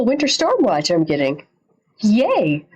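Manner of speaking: ordinary talk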